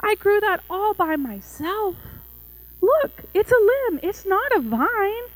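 high, slow, wailing complaint; protesting Little Tree. A narrator's reading. Little Tree objects to losing her own branch, upset and pleading. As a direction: plead up high, drawing out the words.

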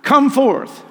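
high, booming command; loud cry. A narrator's reading. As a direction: call out at full voice, as to someone in a tomb. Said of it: "he cried with a loud voice".

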